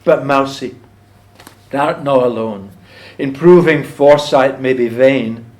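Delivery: slow, weighty, emphatic verse; poem recitation. Acted. Farmer addresses the mouse at the poem's close, solemn recitation. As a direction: declaim it, then let the last line fall away.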